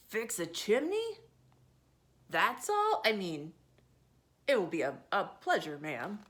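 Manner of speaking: lightly voiced old sailor; a hint of a gruff old seaman; Mister Whiskers. Old sailor answers the lady, mildly gruff.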